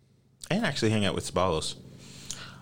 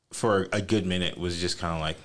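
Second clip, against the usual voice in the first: usual speaking voice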